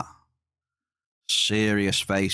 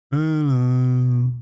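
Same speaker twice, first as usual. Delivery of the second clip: a loud mock in-game hello; a bigger second go at the game sound; louder game greeting; 2 semitones higher, 5 dB louder than usual. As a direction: belt out the hello like the game's sound effect. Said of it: "Are those good sound effects?".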